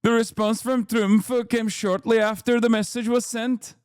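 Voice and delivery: reading a statement in a put-on Norwegian accent; measured, hammed-up Norwegian prime minister accent. Streamer mock-reads the prime minister's statement to chat, Norwegian accent. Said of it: "These accent impressions are so good".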